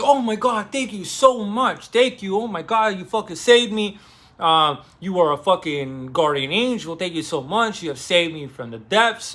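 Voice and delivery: high, loud, overjoyed thanks; acting out a desperate man suddenly rescued; gushing relief. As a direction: gush with relief like he's been saved. Dimitri overjoyed at rescue offer.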